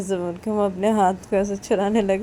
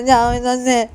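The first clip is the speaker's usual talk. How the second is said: loud, higher-pitched order to leave; raised, insistent voice; angry dismissal; 5 semitones higher, 10 dB louder, slower than usual. Acted. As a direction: shove them away with the voice. Pushing someone away.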